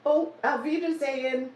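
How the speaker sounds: a playful German goodbye in a German accent. Shown as a demonstration. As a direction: say it like a German, brightly. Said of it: "How's my accent".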